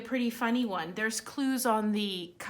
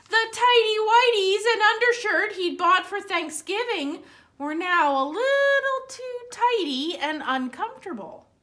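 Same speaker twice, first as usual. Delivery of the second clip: lively storybook read-aloud; reading to children; 7 semitones higher, 7 dB louder than usual. Acted. Librarian reads Santa's Underwear aloud to children, animated. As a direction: read it brightly for the kids at story time.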